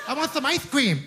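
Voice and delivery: put-on high child voice saying "cream" as "cweem"; high-pitched mock toddler speech, "cream" as "cweem"; child's mispronounced R's. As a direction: say "cream" with a W for the R, like a little kid. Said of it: "He can't pronounce his R's".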